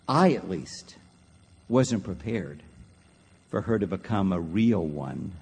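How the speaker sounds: low, halting father with long pauses; restrained tearful confession. Retold or imitated. Father explaining his tears to his wife, low, halting and subdued. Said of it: "why are you crying?".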